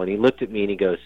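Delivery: normal talking voice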